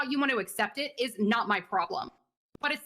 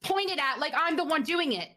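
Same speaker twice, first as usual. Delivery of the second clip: rising angry pitch, slowed and pressed; fed up, pushing back hard at him; heated complaint to a colleague, voice climbing; 5 semitones higher than usual. Acted.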